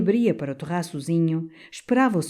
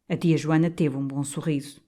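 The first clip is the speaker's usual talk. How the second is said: ordinary delivery, nothing marked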